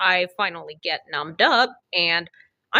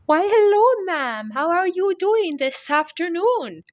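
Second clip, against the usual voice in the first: put-on Eastern European accent; 11 semitones higher than usual. Dental surgeon greeting nervous patient, hammed-up Eastern European accent. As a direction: overdo a sing-song Eastern European accent. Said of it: "butchered that shitty-ass, uh, Eastern European accent".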